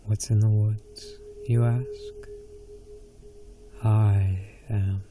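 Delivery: plain reading voice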